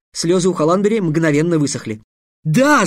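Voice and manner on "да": a louder, higher joyful yell. Acted. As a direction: shout yes with glee. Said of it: "завопил Трикс".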